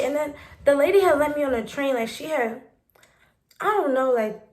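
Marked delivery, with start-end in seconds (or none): none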